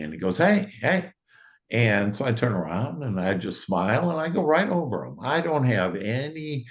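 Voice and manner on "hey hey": higher-pitched mock yell; retold call-out. Guy calling over to a stranger outside a store, imitated yell. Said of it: "yells over to me".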